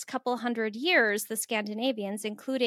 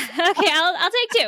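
laughing; 7 semitones higher, 8 dB louder than usual. Real, not acted. Laughing while accepting offer.

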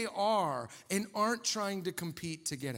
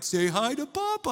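put-on baby talk; 5 semitones higher, 6 dB louder than usual. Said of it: "he goes".

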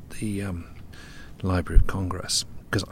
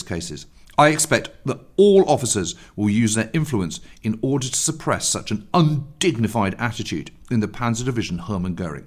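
loud, formal reading of an order; reading a letter aloud; 2 semitones higher, 6 dB louder than usual. Acted. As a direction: read the order out stiffly. Reads out a German order.

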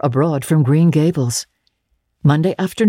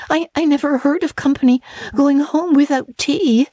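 mourning; 12 semitones higher than usual. Acted. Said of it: "she mourned".

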